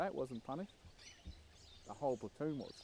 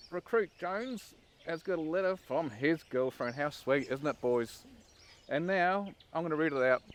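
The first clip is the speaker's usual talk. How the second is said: high, slow sing-song sneer of fake sweetness; mocking sweetness; 5 semitones higher, 7 dB louder than usual. Corporal to the platoon, reading a recruit's letter, slow high mock-sweet. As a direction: tease him like he's a child, drawing it out. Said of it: "the corp would say".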